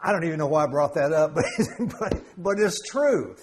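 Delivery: chuckling through the words; amused, laughing aside to the congregation. Real, not acted. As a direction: laugh it off a bit sheepishly. Laughing at his own joke.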